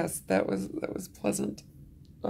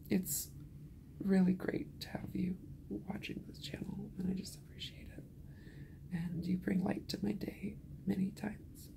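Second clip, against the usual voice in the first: whispering; 10 dB quieter than usual. Real, not acted. Thanking viewers while exhausted.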